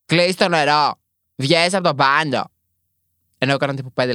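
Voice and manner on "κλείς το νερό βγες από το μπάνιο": loud, higher mock yell of a nagging dad; dad yelling at a kid to get out of the shower, loud mock yell; retold shouting father. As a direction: bark it like a dad yelling through the bathroom door. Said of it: "που μου φώναζε".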